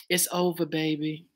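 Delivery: hushed, low R&B singer impression. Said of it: "In my [name] voice".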